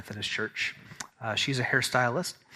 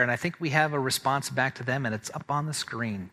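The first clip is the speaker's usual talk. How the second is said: usual speaking voice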